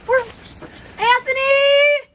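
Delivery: long loud call across the yard. Real, not acted. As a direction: call his name from afar. Calling out across the garden.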